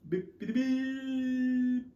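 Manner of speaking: high, slow electronic beeps; mimicked beeping. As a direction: beep like a little gadget, drawn out high. Imitating a device beeping.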